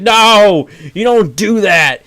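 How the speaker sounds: high, loud indignant cry; outraged protest. Retold or imitated. Fan yelling at the show in outrage. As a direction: shout it at the screen in outrage. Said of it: "I'm like".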